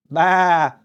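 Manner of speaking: bleated Maa, high and loud; lamb bleat. A narrator's reading.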